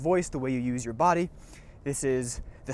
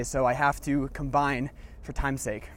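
normal talking voice

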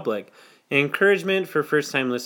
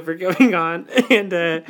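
ordinary delivery

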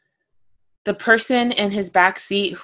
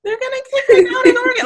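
high, rushed pretend panic; hammed-up squeal for rescue; mock cry for help; 3 dB louder, faster than usual. Host imagining what the mom should scream, mock panic.

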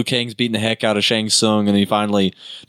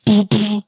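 high poof sound effect; 10 semitones higher than usual. Retold or imitated. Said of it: "he's like".

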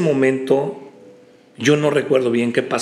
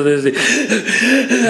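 high, hitching mock sobs; imitated sobbing; 9 semitones higher than usual. Guest showing how he cried like a child, imitated sobbing. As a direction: imitate uncontrollable crying in jerky bursts. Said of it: "así, de esas veces de".

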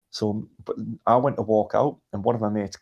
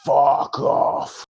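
breathy, unvoiced mock snarl; harsh retold snarl; 3 semitones higher, 5 dB louder than usual. Bloke in Chris's face, mock snarl. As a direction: spit it out in a rough breath. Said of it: "got in Chris's face and just went".

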